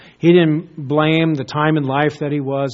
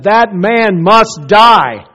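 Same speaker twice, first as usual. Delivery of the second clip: slow, loud, much higher furious verdict; angry outburst; 6 semitones higher, 9 dB louder, much slower than usual. Retold or imitated. King David lashing out in anger, sentencing the man to death. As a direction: spit out each word as a furious king. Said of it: "he lashed out and said".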